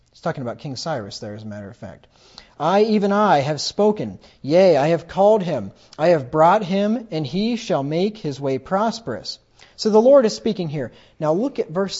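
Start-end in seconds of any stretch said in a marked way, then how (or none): 2.6-9.4 louder, solemn reading of God's words; scripture reading. Acted. Preacher reading God's words aloud to the congregation, louder and solemn. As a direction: declaim the verse like the voice of God.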